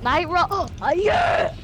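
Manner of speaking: loud high victory yell; cheering a big find; excited shout. Real, not acted. Gets medallion, shouts.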